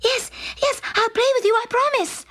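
high, pleading princess voice; eager princess cry. A narrator's reading. Princess pleading with the frog for her ball. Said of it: "cried the princess".

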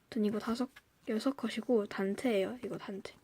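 counting in a whisper. Real, not acted. Muttering while counting cards. (속삭이며 세기)

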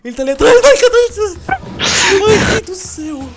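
frantic fast panicked shout; being chased. Real, not acted. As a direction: scream it out while fleeing. Monster behind her.

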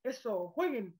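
usual speaking voice

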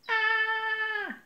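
long, high distant shriek; sustained shrill cry; high-pitched scream. A narrator's reading. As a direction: scream out "aaah" in alarm. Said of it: "Een hoge gil galmde".

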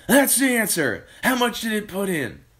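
high, eager exclamation, loud at the start; excited cry. A narrator's reading. Mister Cranky to George about the oil, excited cry. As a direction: burst out with it, then ask eagerly. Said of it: "cried Mister Cranky".

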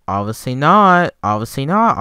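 loud, hyped-up repeat; hyped shout. Real, not acted. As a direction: shout it out, pumped. Repeats the phrase loudly, hyped.

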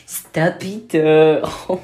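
normal talking voice

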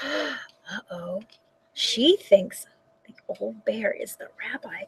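hushed, breathy whisper to the listeners; whispered aside. A narrator's reading. Storyteller whispering to the children about the mix-up. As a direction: lean in and whisper the secret. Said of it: "storyteller aside, gasp and whisper".

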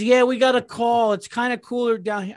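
high, breezy mock wife voice; mimicking wife. Husband mocks wife's reply about the heat, high and breezy.

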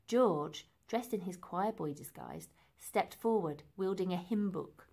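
ordinary delivery, nothing marked

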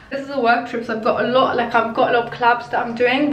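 ordinary talk, nothing marked

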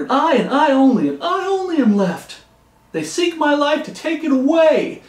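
high, loud, accusing outcry to God; worked-up, whining protest hurled upward; indignant complaint. Acted. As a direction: wail the grievance loudly, pitch leaping up on the stressed words. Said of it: "Can you imagine the first time".

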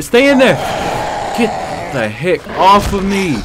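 high, loud, slowed shouting; loud yell. Real, not acted. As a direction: shove the zombie away with your voice. Fending off zombie, yelling.

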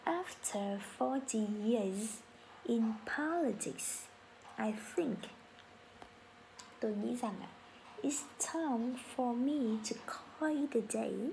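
very slow, syllable by syllable teacher read; slow model sentence. Shown as a demonstration. Teacher reading for class to repeat, very slow. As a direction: drag it out so learners can follow. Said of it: "Cả nhà mình cùng nói lại một lần nữa là".

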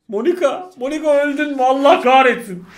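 loud, high anguished cry; frantic calling out. Real, not acted. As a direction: call out desperately then curse. Calling out in panic. (Monika'ya çaresizce seslenip küfretmek)

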